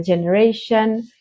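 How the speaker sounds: normal talking voice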